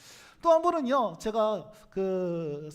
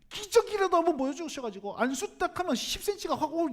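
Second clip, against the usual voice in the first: higher, insistent plea for 기적이라도; pleading prayer; 6 semitones higher than usual. Retold or imitated. Preacher presses God again for a miracle, pleading higher. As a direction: beg harder this time.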